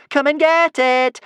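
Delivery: high, loud, slow singsong; singsong taunt. A narrator's reading. Kamikaze daring Norbert to grab it, singsong taunt. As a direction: sing it out to goad him. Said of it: "sang Kamikaze".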